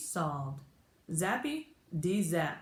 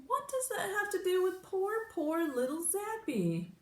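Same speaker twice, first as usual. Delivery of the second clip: teary voice pitched way up; tearful, high plea; 13 semitones higher than usual. A narrator's reading. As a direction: near tears, begging. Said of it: "tears brimming".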